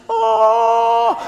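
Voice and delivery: high, drawn-out cry of awe; awestruck. Acted. Guest walking into a posh hotel lobby, awestruck. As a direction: gasp out a big wowed oh. Awed on entering fancy hotel.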